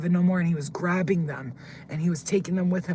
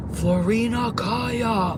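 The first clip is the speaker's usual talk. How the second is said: slow, drawn-out, higher menacing call; scary voice; 6 semitones higher, 5 dB louder, much slower than usual. Retold or imitated. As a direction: imitate a scary giant calling out slowly. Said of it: "he starts talking with this deep, like, scary voice".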